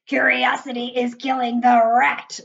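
loud, put-on giant voice; giant's character voice. A narrator's reading. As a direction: retort loudly as the giant. Narrator voices the giant's retort.

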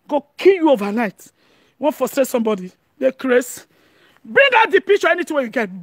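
ordinary talk, nothing marked

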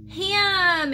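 put-on little kid's high, loud shout; high loud shout of ham. A narrator's reading. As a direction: call out the word like an excited toddler. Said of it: "Andy shouted".

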